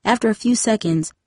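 ordinary delivery

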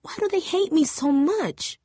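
high, quavering plea; voice shaking with emotion. A narrator's reading. Angel asking Jamie why they hate her, voice shaking. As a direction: near tears, asking it of nobody. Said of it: "Angel's voice shook with emotion".

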